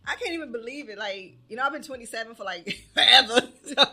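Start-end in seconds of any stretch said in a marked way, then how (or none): 2.4-3.8 laughing loudly through the words; loud laugh spilling over the punchline. Real, not acted. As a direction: break into a big laugh on forever. Joking about being twenty-seven forever.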